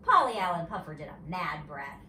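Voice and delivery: usual speaking voice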